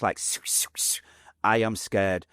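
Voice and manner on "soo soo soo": whistling bullet whooshes; short "soo" whooshes; imitated passing bullets. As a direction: mimic bullets whizzing past without voice. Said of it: "I can feel the bullets".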